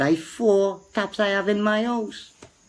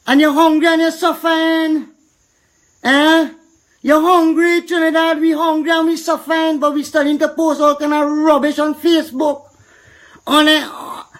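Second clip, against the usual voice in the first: impassioned rant; 8 semitones higher, 3 dB louder than usual. Real, not acted. Ranting to Trinidadians about hunger.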